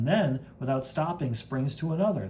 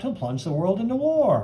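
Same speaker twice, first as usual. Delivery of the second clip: ordinary talk, nothing marked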